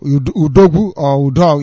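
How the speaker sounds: ordinary talk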